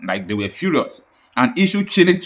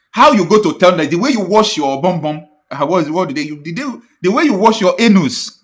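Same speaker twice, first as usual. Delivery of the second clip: indignant outburst; 5 semitones higher, 5 dB louder than usual. Real, not acted. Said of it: "indignant outburst".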